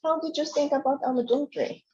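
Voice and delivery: ordinary talk, nothing marked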